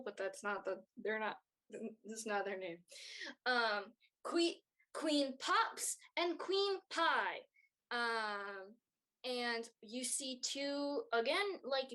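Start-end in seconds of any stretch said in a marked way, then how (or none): 4.2-7.4 royal announcer. Acted. Announcer finally names the queens.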